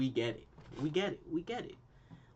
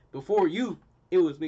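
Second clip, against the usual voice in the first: said again, much louder and pressing; louder insistence; 3 semitones higher, 7 dB louder than usual. Acted. Ranting vlogger repeating Cap's line to viewers, much louder. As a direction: hammer the claim home the second time.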